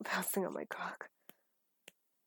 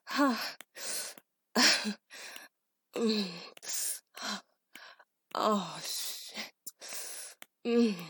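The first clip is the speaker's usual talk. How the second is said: loud moans; 3 semitones higher, 6 dB louder, much slower than usual. Acted. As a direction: moan out, building. Moans near climax.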